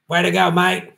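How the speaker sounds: badly faked Australian accent; awful Australian accent. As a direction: have a poor go at an Australian accent.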